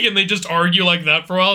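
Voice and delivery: usual speaking voice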